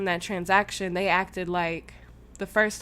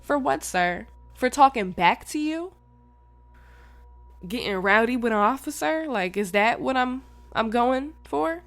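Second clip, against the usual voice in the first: raised, louder indignant questioning; rowdy back-talk; 6 semitones higher, 6 dB louder than usual. Retold or imitated. Arrestee questioning the officer about the charge, rowdy indignant back-talk. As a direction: re-enact challenging him, pitch up. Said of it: "I was just being rowdy".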